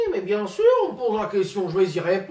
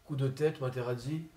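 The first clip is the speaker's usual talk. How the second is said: Zinedine Zidane impression; 2 semitones lower, 7 dB quieter than usual. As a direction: mumble like a shy, sulky footballer. Comedian impersonates Zinedine Zidane.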